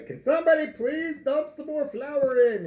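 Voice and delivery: high-pitched exasperated call for help; mock plea. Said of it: "and then I'd say".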